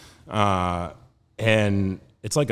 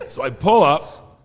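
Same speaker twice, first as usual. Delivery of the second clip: ordinary delivery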